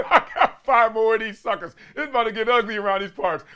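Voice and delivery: laughing through the words. Real, not acted. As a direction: chuckle at your own predicament. Laughs at days left solo.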